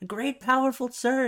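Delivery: hammed-up panicky flattery; groveling old man. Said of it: "And he's like".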